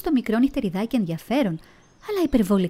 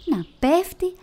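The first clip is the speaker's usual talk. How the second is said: falling, rising; 8 semitones higher, slower than usual. A narrator's reading. Narrator tells the bat falling, pitch climbing. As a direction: let πέφτει climb as he tumbles.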